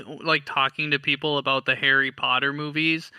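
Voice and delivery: usual speaking voice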